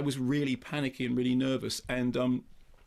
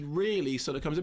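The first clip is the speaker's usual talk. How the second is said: ordinary delivery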